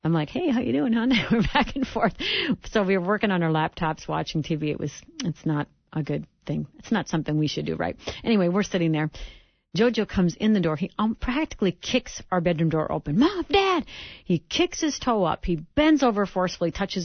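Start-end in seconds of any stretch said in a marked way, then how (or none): 0.3-1.1 mock sweet. Mock cute email greeting. 13.2-13.8 high, loud excited shout; excited call. Retold or imitated. Son bursting in shouting.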